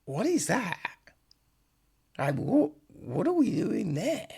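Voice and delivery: high-pitched, incredulous questioning; baffled disbelief. Retold or imitated. Baffled viewer questioning a weird scene, high and incredulous. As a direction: voice leaps up in mock bewilderment.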